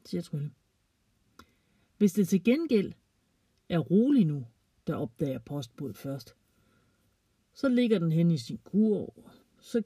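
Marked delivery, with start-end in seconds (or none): none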